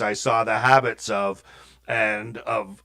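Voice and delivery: usual speaking voice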